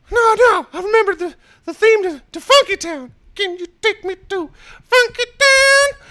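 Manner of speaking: very high, loud cartoon squeal; squeaky excited cartoon towel; South Park voice. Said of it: "Do the South Park voice".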